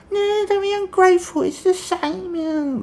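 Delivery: very high, loud mum voice; mock mum. Mum fobbing her kid off with knockoff toys. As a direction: coo it like a patronising mother. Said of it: "mum answers kid".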